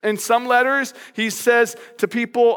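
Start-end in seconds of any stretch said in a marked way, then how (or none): none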